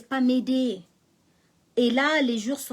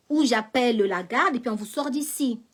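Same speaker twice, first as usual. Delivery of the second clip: ordinary talk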